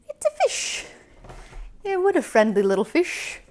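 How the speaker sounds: very high, excited reveal; cheerful fish reveal; delighted squeal. Pretend. Introducing friendly fish.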